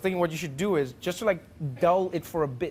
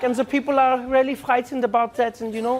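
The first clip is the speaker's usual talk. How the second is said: higher, louder German friend voice; earnest German-accented explanation, pitched up; 7 semitones higher, 6 dB louder than usual. Acted. Friend reporting that diners were frightened, earnest. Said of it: "my friend was like".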